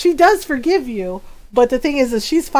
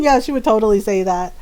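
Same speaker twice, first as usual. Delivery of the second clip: usual speaking voice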